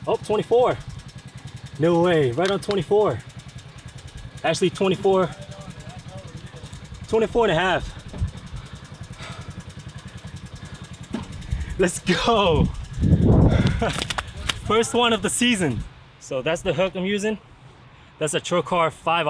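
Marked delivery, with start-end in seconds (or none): 0.1-12.6 excited. Real, not acted. Measuring a keeper grouper. 12.7-14.6 excited breathy laugh. Real, not acted. Celebrating first keeper fish. 14.7-15.8 proud excitement. Real, not acted. Celebrating first keeper fish.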